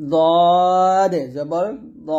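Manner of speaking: দদ held very long and loud; slow model, দ thick with "aw"; heavy Dad. Shown as a demonstration. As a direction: stretch দদ, then a full rounded দ. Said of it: "মোটা হবে".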